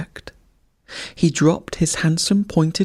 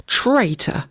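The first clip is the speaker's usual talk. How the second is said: higher cry of "Traitor!"; magpie's cry; 5 semitones higher than usual. A narrator's reading. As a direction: call it out like a magpie. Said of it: "cried the magpie".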